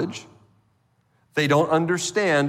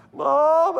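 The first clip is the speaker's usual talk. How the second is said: high, drawn-out call for mom; little Johnny; 13 semitones higher, 4 dB louder than usual. Acted. Child right by the parents' bed, high whining call. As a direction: imitate a child pestering a sleeping parent. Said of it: "That's little Johnny".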